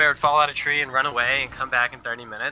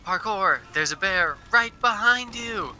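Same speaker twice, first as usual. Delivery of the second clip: urgent warning yell; 8 semitones higher than usual. Retold or imitated. Scott warning Parkour about the bear, alarmed call. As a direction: shout the warning fast, pitched up.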